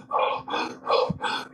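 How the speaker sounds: mock gasp for air after a hard swim; imitating heavy breathing. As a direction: gasp like a panicked diver on shore. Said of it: "he's just breathing real heavy".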